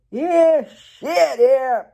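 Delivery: squeaky high mock cheer, loud; a jokey high-pitched stranger whooping; mock excited yelp. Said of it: "someone would be like".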